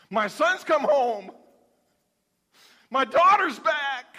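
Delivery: put-on rising glee of a father whose child came home; joyful father. Joyful father ordering feast.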